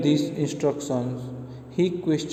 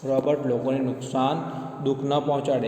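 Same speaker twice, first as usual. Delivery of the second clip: normal talking voice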